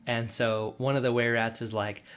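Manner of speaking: normal talking voice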